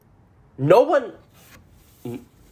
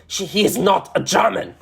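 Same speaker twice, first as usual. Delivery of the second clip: higher, emphatic mock-angry line; angry retelling; 5 semitones higher than usual. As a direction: act out his angry insistence. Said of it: "like, angry".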